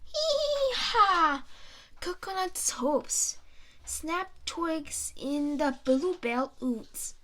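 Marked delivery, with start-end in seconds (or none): 0.1-1.4 loud high hee-haw bray; donkey bray. Acted. Narrator braying as the donkey Coconut.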